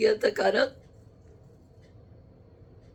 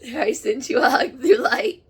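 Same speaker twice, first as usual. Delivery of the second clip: louder, tearful laughter; laughing through tears; 3 semitones higher, 6 dB louder than usual. Real, not acted. Medium sending a spirit a hug, laughing through tears. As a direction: laugh while crying.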